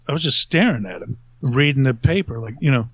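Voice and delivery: ordinary delivery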